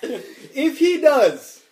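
giggling through the words; laughing. Real, not acted. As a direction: laugh it out, slowly. Bursting out laughing.

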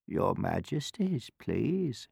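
soft, humble request; soft old woman. A narrator's reading. Old woman curtseying to royalty, softly. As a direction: ask softly and politely.

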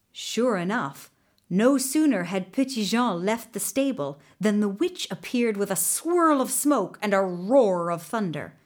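ordinary delivery, nothing marked

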